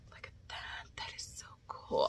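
hushed, impressed whisper. Real, not acted. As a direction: whisper it in awe. Hushed over body cream.

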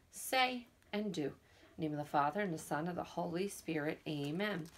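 soft, low prayer voice. Acted. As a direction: pray quietly with the children. Leads a prayer.

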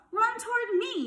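very high, excited gander call; yelping gander. A narrator's reading. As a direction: yelp it like an eager gander. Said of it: "yelped the gander".

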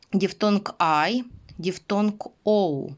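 ordinary talk

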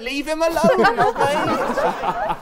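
high, loud, laughing telling-off; laughing scold. Real, not acted. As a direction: shoo her off Tyler through laughter. Host mock-scolds flirting guest.

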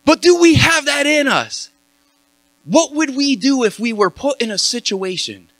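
high, impassioned pulpit push, slowing on key lines; rally the church, lifted and earnest; preaching. Acted. Preacher urging the congregation to stand for God, impassioned.

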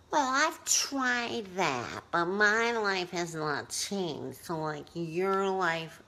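skeptical whining. Retold or imitated. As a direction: voice the doubter, sulky. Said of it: "something in your head said".

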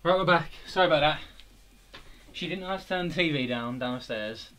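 out of breath, panting between words; breathless after running back. Real, not acted. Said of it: "I'm literally so out of breath".

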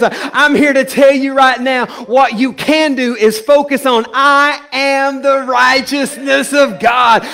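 loud, high, impassioned declaration; full preaching shout. Acted. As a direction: shout the truth to the congregation.

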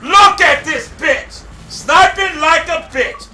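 slow, loud, high jeer; angry shout. Real, not acted. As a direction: drag out the insult in anger. Gamer insults sniper.

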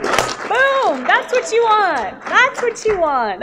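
high, loud, drawn-out mock victory cry; triumphant whoop. Actor to director after nailing the take, triumphant shout. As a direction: crow it loudly, far above normal pitch.

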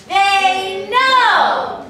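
high, loud, stretched-out call; interrupting chorus. Shown as a demonstration. Director showing kids how to interrupt Genie, loud drawn-out call. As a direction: cut in loudly, drawing out both words. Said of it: "interrupt them and say".

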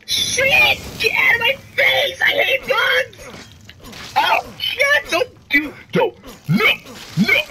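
high, loud panicked screaming; shrieking at the bugs again; freaking out. Real, not acted. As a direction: scream like you're being swarmed. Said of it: "freaking out like a little girl".

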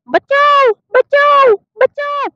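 put-on very high squeaky baby voice calling for help; tiny toy baby's high pleading cry. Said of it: "baby चिल्ला रही है".

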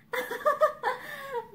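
a high, hard little laugh; a short reckless laugh, half a sob. Acted. Girl giving in to Mickey's invitation, hard little laugh. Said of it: "suddenly she laughed, a hard little laugh of recklessness".

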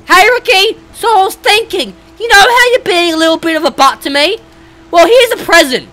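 high, loud mocking greeting; loud taunting call. Real, not acted. Taunting Ricky before blowing him up, loud mocking call. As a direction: shout a sarcastic hello at him.

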